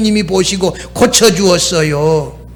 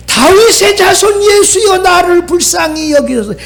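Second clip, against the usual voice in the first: high, insistent retold plea; crying out; 10 semitones higher than usual. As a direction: imitate a blind beggar calling for mercy. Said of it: "뭐라고 소리쳐요?".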